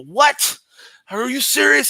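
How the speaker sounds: high, loud, shocked retold outburst; incredulous. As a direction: exclaim in hammed-up disbelief. Acts out shock at the find.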